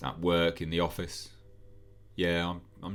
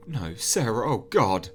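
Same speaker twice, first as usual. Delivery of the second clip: mild mock scream; 5 semitones higher, 7 dB louder than usual. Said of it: "Phil stands there screaming".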